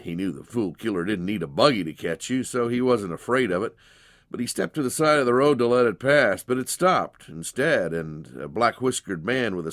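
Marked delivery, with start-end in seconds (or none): none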